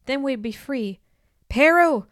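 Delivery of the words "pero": loud, high angry call; shouted name. A narrator's reading. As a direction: bellow the name after her. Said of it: "Carper shouted".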